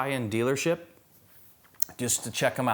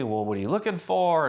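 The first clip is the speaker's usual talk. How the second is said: higher, obliging sales rep voice; very nice; 6 semitones higher, 4 dB louder than usual. Retold or imitated. Sales rep talking to a well-dressed customer, very nice. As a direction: imitate a rep eager to please. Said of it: "he's very nice to me".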